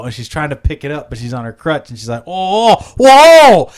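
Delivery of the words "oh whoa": high, loud wail of losing balance; mock falling cry. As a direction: cry out as if toppling off crutches. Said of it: "And she falls to the ground".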